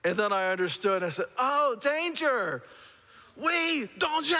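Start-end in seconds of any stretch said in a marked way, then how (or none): 1.4-4.4 realization. Retold or imitated. Finally understanding, shouting back.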